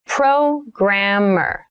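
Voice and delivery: slow, drawn-out teacher demo; slow model read. Shown as a demonstration. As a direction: stretch every sound for learners. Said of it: "teacher models word, third take".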